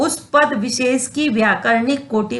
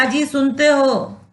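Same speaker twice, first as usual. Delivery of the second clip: calling out; 3 semitones higher than usual. Shown as a demonstration. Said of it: "बोलते हैं ना".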